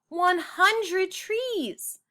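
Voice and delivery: high, slow joyful outburst; excited exclaim. Acted. Judy realising her dollars make a hundred trees, high excited cry. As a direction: savour it like a victory.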